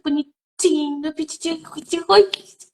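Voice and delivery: put-on a parent cooing babytalk at a small child; mock baby talk. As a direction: coo it the way parents talk to toddlers. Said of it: "ela vai falar de forma infantilizada".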